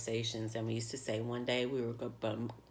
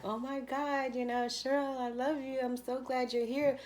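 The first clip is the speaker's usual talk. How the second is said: much higher, gushing with delight; greeting her late friend with delight, much higher; overjoyed greeting; 11 semitones higher than usual. Retold or imitated. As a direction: squeal it like seeing a lost friend again. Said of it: "And I was like".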